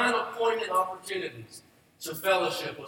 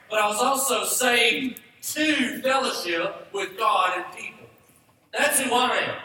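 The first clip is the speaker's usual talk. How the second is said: ordinary delivery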